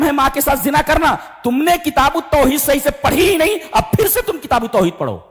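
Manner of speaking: high-pitched, impassioned scolding; angry teacher's rebuke. Retold or imitated. Scholar scolds students who misunderstood tawhid, angry rebuke. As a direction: act out an indignant scholar building to a high pitch. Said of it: "حضرت کو غصہ آ گیا".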